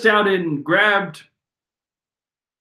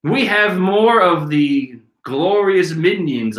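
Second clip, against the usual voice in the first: ordinary talk, nothing marked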